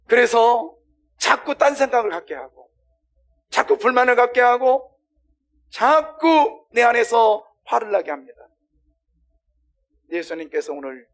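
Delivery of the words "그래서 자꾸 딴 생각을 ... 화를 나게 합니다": loud, raised preacher's voice; forceful preaching. Acted. Preacher warning how the devil blocks us, forceful preaching. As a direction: hammer each repeated point home.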